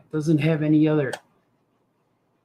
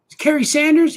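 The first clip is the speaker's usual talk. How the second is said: mock high voice; 11 semitones higher, 5 dB louder than usual.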